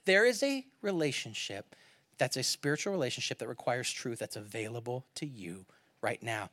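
breathy intimate whisper of Jesus; hushed whisper. Acted. Preacher voicing Jesus to the woman, whispered. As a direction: lean in and whisper the invitation.

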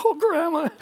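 a loud, high-pitched oh grandma; exasperated protest. Retold or imitated. Grandson protesting his grandma's rib accusation, high and loud.

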